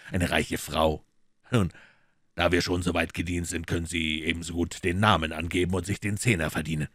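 cool detective teasing the maid; dry detective. Acted.

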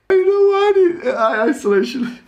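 high, helpless cracking up; laughing. Real, not acted. Blaming isolation for a silly joke, laughing hard. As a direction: squeeze the words out through laughter.

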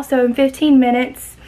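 ordinary talk, nothing marked

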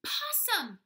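loud, high and slow reveal; delighted big reveal; excited reveal. A narrator's reading. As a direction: draw out the surprise answer.

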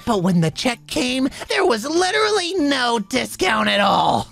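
ordinary talk, nothing marked

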